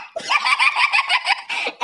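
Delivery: very high shrieking villain cackle; evil cackle. Acted. Schoolgirl mocking her classmate with a shrieking cackle. Villain laugh after being accused.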